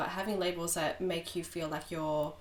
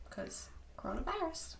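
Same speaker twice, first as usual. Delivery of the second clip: whispered; 11 semitones higher, 7 dB quieter than usual. Pretend. Joking whisper about being sick.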